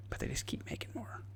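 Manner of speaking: hushed aside. Real, not acted. Conspiratorial aside about nukes.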